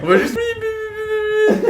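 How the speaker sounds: beep imitation. Jokes about bleeping a name.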